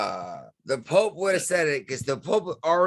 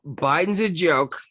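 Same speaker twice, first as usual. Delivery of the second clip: slow, louder, higher, every word punched; emphatic declaration; 4 semitones higher, 5 dB louder, slower than usual. Shown as a demonstration. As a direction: announce it like a verdict. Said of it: "how is this?".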